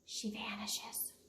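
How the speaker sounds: soft slow whisper; whispered vanish. A narrator's reading. Reading to schoolkids, a slow vanishing whisper. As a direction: let the words disappear, breathy and slow.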